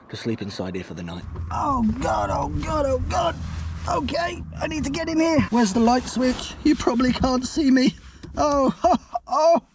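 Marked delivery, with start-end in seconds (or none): 1.5-9.6 high flustered panic; flustered squealing. Real, not acted. Climbing into cramped car.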